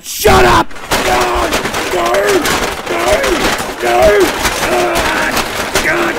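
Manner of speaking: high agonized yells; anguished screaming. Real, not acted. Streamer raging at the news about Rock Ape, anguished screams. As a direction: scream out grief and rage.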